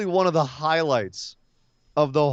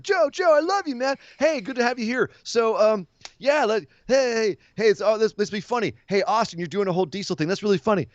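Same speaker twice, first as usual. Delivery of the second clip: higher, eager, goofy rambling voice; dorky goofy Gargano; 6 semitones higher, faster than usual. Retold or imitated. Johnny Gargano nervously gushing at Joe, higher goofy voice. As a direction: play the awkward fanboy gushing at a tough guy. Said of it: "just be his dorky, goofy self and be like".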